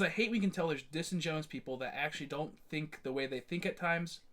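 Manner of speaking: ordinary delivery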